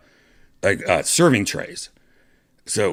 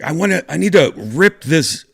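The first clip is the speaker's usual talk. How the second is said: loud, higher, stumbling urgency; urgent demand; 10 semitones higher, 8 dB louder than usual. Retold or imitated. Doctor telling crew he must rip off a panel, loud hurried demand. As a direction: blurt it out in a hurry.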